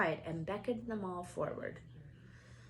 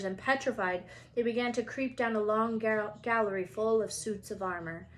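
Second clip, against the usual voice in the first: ordinary delivery, nothing marked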